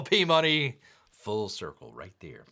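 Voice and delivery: ordinary talk, nothing marked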